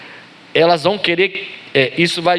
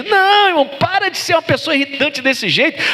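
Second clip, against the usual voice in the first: exasperated outcry; 9 semitones higher than usual. Acted. Preacher scolds the driver.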